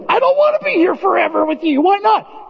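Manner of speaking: high, petulant mock whine; whiny refusal. Pastor acting his own fed-up reply, high and whiny. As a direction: sulk like a fed-up kid.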